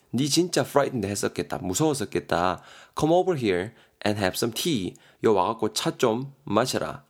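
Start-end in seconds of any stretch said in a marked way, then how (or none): 3.0-5.0 아주 느리게 한 단어씩 또박또박 읽기; 학생들이 따라오게 천천히 읽어주기. Shown as a demonstration. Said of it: "예문 천천히 낭독". (예문을 아주 느리게 또박또박)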